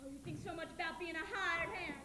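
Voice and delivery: ordinary talk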